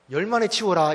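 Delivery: loud, pushy nagging order; mock yell. Parent nagging a child to tidy up, mock yell. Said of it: "막 이렇게 소리칩니다".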